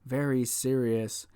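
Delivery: soft, low, solemn imitation; put-on sombre announcer hush; mock sad voice. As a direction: drop low and hushed, badly grave. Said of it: "they're all acting the, the sad voice".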